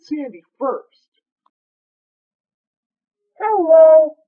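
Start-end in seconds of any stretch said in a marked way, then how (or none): 3.4-4.2 big loud greeting to a dog; loud hello. Real, not acted.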